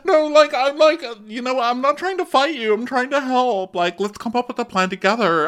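high-pitched pleading impression; mock breakdown. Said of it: "spotting that shit during a breakdown".

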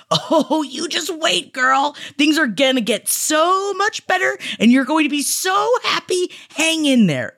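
high, gushing pep talk; excited encouragement. Acted. Reaching through time to reassure her, excited encouragement.

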